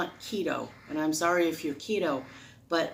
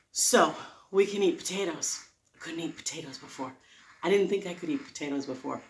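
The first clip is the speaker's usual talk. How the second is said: normal talking voice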